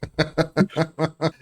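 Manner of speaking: high-pitched chuckle; quick run of amused laughter. Real, not acted. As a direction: laugh it out in a string. Laugh at counselor remark.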